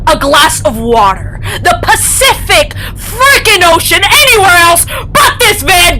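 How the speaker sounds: crying rant. Acted. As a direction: break down while yelling. Ranting at the kid.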